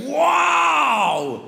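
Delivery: high, long drawn-out wow; mock amazement. Parisian hears how long the Belgian has lived there, hammed-up amazement. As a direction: exclaim in exaggerated astonishment.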